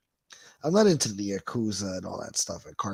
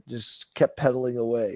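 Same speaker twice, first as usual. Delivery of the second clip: ordinary talk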